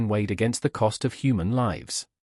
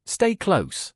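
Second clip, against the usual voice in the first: calling out; 8 semitones higher than usual. A narrator's reading. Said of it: "I called".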